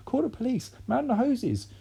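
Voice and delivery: high, urgent cry; mother calling for help in panic; panicked cry. A narrator's reading. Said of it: "cried Missus Cranky".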